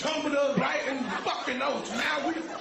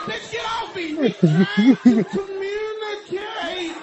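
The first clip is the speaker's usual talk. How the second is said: higher, louder irritated shout; higher, louder shout at the girl pulling him to the water; mock yell; 7 semitones higher, 8 dB louder, slower than usual. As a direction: shout her off, drawn out. Yelling at the girl.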